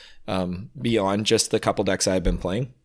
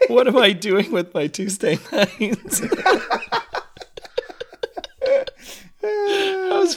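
laughing hard; 5 dB louder, slower than usual. Real, not acted. Laughing hard after a joke.

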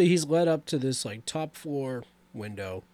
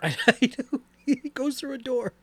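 laughing; 11 semitones higher, 4 dB louder than usual. Real, not acted. Laughing mock of the scene.

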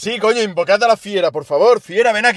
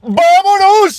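excited cheer; 7 dB louder, much slower than usual. Real, not acted. Celebrating a correct guess.

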